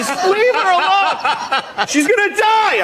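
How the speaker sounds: very high, slow outraged yell; angry bystander shout. Retold or imitated. Bystanders on the sidewalk shouting at the man, very high outraged yell. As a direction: scold like a horrified stranger.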